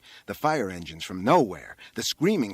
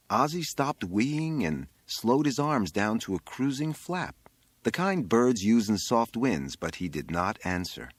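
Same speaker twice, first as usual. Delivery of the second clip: ordinary delivery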